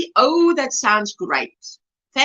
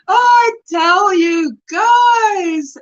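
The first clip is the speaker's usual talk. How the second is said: overcome with joy; 7 semitones higher, 5 dB louder, much slower than usual. Real, not acted. Moved greeting to livestream viewers.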